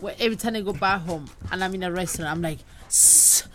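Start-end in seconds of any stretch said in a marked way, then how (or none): 2.9-3.4 long, sharp shushing; drawn-out "shh" for silence; hushing shh. Acted. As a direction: shush a noisy restaurant. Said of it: "I don't physically say".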